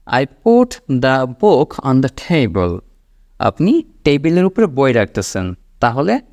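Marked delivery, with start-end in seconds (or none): 0.1-2.8 very slow, each word clear; slow model sentence. Shown as a demonstration. Teacher showing learners what to say at the sink, very slow. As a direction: lay it out slowly to be repeated. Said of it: "কোনো জিনিসের উপরে কোনো একটা কিছু রাখতেছেন".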